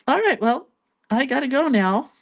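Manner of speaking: high, loud hurried brush-off; bright fake politeness to get away fast; awkward exit. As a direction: raise the pitch and bail out of the chat. Awkwardly escaping a conspiracy talker.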